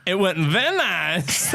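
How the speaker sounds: slow, swooping mock-Kazakh accent; stretched-out comic catchphrase; Borat. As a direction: play a surgeon doing Borat. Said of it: "surgeon doesn't come out and go".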